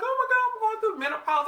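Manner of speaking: high, fast panicked wail; frantic, sure it's menopause; retold panic. Woman fearing early menopause, high fast panic. I'm crying and carrying on.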